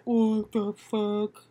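slow, drawn-out disbelief; stunned reaction. Retold or imitated. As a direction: act out a slow, dumbfounded response. Imagined stunned reaction to story.

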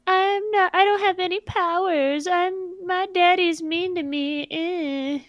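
high, mocking whiny girl voice; whiny heroine mockery. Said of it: "she's whiny and annoying".

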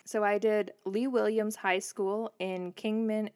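ordinary talk

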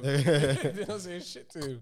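slow, loud laughing line. Real, not acted. Joking about his friend, loud and slow with laughter. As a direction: laugh the words out slowly.

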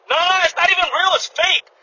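high scoffing customer voice; lightly voiced annoyed customer; mocking the customer. Customer voiced dismissing mask rule.

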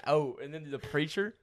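usual speaking voice

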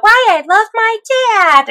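mock a proud child's essay title; kid's voice. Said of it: "you just hear the kid talking about".

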